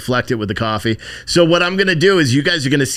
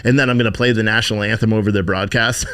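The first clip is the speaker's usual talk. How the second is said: normal talking voice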